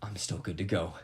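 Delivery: soft relieved whisper to himself. Retold or imitated. Checking his bank account at the register, relieved whisper. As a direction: hush it, just for you.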